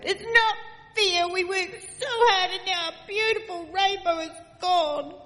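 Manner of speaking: put-on high child's voice, wailing that it's unfair; wailing complaint. Children wailing to Nanny that their rainbow is gone. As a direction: whine it like a heartbroken child. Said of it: "we wailed".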